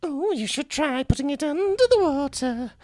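high, sing-song little voice. Retold or imitated. Brother retelling the chapter, little voice from nowhere, high-pitched. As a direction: put on a high little ghostly voice. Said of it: "a little voice goes".

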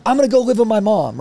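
loud, high bratty threat; teenage threat. Retold or imitated. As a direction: throw it at dad like a teen. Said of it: "the typical teenager threat".

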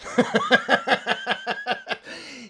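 sudden high cackling laugh; high burst of laughter. Real, not acted. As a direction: crack up at your own dark joke. Bursts out at grim joke.

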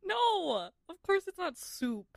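high, indignant protest; exasperated. Real, not acted. Co-host rejects cereal as soup, exasperated. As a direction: push back in playful outrage. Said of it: "I'm so mad".

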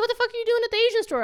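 fast, high-pitched indignant demand; exasperated retold challenge. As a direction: rattle it off high, affronted. Repeats own confrontation.

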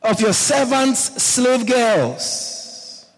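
high, indignant reproach, stretched out phrase by phrase; hammed-up telling-off at normal volume; mock scolding yell. Saul's daughter scolds David for dancing half naked, mock scolding. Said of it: "Started yelling at him".

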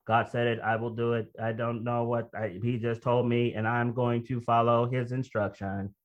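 low monotone automaton; robot voice. Acted. Preacher mimicking an automaton blindly obeying God, low robotic monotone. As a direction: recite it like an obedient robot, pitch held level and low. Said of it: "It means a robot".

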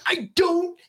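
slow, high-pitched retold exasperation; losing it. Dad dismisses son's online friends, losing it. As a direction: re-enact dad's outburst, stretching each word. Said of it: "and I lost my ever-living shit".

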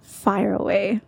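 slow, drawn-out radio host imitation; imitating radio voice. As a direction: echo it like a radio DJ. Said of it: "It's that radio voice coming through".

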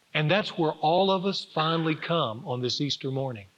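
ordinary talk, nothing marked

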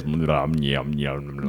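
low soft greedy yum yum; low yum noise. Shown as a demonstration. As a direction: murmur yum like savouring food. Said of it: "I've been going like this".

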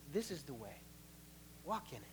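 low, soft, guiding; hushed guidance from behind; soft voice behind you. Acted. A voice behind the believer, soft and low.

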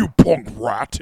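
mocking low, slow tough-guy voice; drawn-out mock deep voice; Dijak. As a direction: parrot it back in a forced deep voice. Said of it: "Is that how he talks?".

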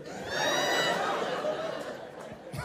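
big laugh from the crowd; high burst of laughter. Real, not acted. Audience laughter at joke.

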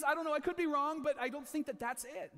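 ordinary talk

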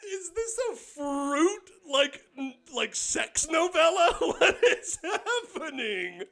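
high squeaky laughter; words squeezed out through laughs; laughing hard. Real, not acted. Laughing fit.

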